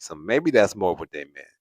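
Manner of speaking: usual speaking voice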